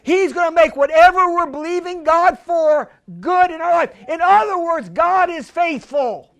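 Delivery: normal talking voice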